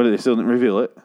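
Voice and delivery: ordinary talk, nothing marked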